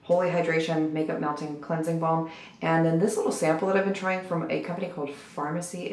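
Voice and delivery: hushed, not to wake anyone; quiet voice. Real, not acted. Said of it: "Everyone is asleep. It's quiet".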